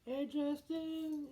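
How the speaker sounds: very high, drawn-out mock mom voice; girlfriend's mom. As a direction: sing out a greeting in a put-on falsetto. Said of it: "her mom just come out of nowhere".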